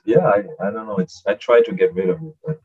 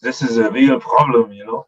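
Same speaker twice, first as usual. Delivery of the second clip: thick German accent; 6 dB louder than usual. Shown as a demonstration. Showing a thick accent isn't charming, exaggerated German accent. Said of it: "talk like a German with a strong, thick German accent".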